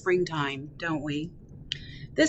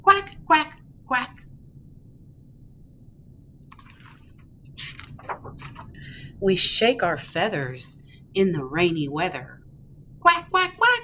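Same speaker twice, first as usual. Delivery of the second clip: happy duckling, picture-book reading. Acted. Ducky off to play in the rain, happy read-aloud. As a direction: read playfully to toddlers, bouncing the quacks.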